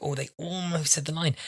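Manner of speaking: high, soft disappointed fan; mock disappointment. Fan lamenting a missed line, high soft disappointment. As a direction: sigh it like a let-down fan.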